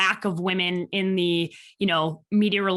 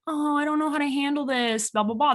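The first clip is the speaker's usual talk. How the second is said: whiny student; 7 semitones higher than usual. Retold or imitated. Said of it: "they're not gonna be like".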